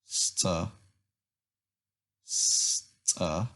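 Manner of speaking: Sta said short and separate; teacher drilling the first syllable Sta; first syllable alone. Shown as a demonstration. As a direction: isolate Sta for the learners. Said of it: "The first syllable".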